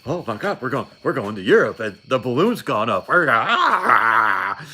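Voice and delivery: hammed-up young recruit yelling in panic; mock panicked excitement. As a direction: play your younger self losing it over going to war. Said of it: "I was all".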